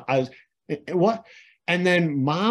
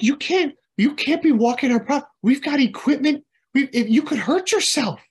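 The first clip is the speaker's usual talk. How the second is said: mock anxious mom; 10 semitones higher, 4 dB louder than usual.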